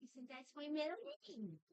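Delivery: high, soft put-on dog voice; voicing the dog. Owner asks as his dog, high and soft. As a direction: ask it sweetly as the dog.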